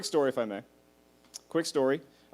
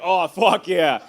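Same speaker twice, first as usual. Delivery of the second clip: laughing through it; amused laugh, louder; 2 semitones higher, 7 dB louder, much slower than usual. Retold or imitated. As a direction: crack up as you say it. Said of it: "I was like".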